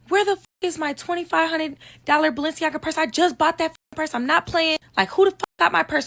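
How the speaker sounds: imitating her drunk friend's high-pitched outrage; mock a frantic rant about a lost designer purse; shrill-voiced imitation of a panicked, angry girl; outraged friend.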